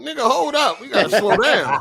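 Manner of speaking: high-pitched, laughing plea; laughing protest. Real, not acted. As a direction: laugh through telling him to ease off. Laughing while halting a co-host.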